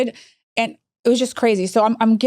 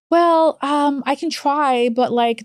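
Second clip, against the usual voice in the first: higher, hesitant mock official; imitated reluctant worker; 6 semitones higher, much slower than usual. Agency worker answering executive about sending nurse, mock reluctance. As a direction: hedge like a reluctant agency rep, lightly mocked.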